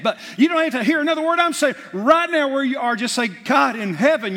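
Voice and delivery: high, loud, impassioned preacher's cry; preaching at full force. Acted. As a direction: lift the room with a fervent call to return.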